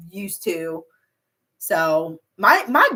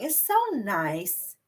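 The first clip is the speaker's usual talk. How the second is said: usual speaking voice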